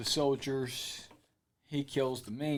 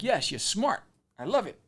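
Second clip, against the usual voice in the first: pleased approval; 6 semitones higher than usual. Retold or imitated. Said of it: "So he's like".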